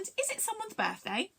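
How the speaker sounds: high, eager question; excited alien. A narrator's reading. Aliens wonder about the present, excited. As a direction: ask it high, full of anticipation. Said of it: "became a bit excited".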